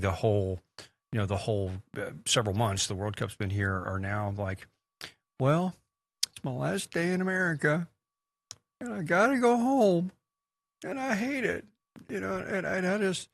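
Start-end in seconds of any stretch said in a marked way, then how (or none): 5.4-13.3 much higher, strained near-tears lament; mock weepy farewell, voice climbing high and thin; tearful European tourist. Departing European vlogger on last day, imitated tearful wail. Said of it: "and they just start crying".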